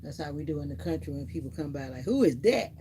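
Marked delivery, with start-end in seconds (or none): 2.0-2.7 loud suspicious call of who is that. Retold or imitated. Calling out loudly at a stranger at the door. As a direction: holler it at someone coming up the drive. Calling out at a visitor, country style.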